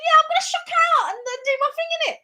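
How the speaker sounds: high, fast, cocky brag; cocky boast. Retold or imitated. Answering her friend Rachel, high fast cocky brag. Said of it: "I was going".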